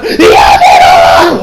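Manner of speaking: drawn-out yelled name; mock scream. Retelling the anime scene, a drawn-out yelled name. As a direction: stretch the name into a big shout. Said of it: "she screams out".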